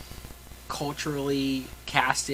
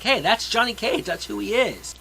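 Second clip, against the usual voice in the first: higher, louder imitation of fans recognizing someone; excited fan; 8 semitones higher, 8 dB louder than usual. Video game fans recognizing Johnny Cage, excited. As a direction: play an excited fan spotting a favorite.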